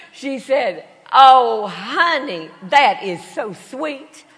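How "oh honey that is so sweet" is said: gushing, drawn-out Texan drawl; hammed-up Texas woman, loud opening then stretched words; Southern Texan accent. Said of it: "see if I can do this".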